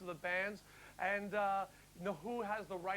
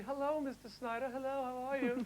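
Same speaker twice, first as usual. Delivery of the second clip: sugary polite greeting, pitched up; polite parents; 6 semitones higher than usual. Retold or imitated. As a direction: play the fawning neighbour parents. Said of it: "came across to say".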